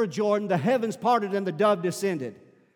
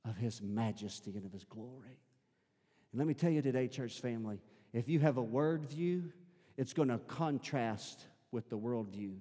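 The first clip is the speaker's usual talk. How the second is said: much softer and lower, intimate; hushed preaching; 8 semitones lower, 11 dB quieter than usual. Real, not acted. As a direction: draw them close in a hush.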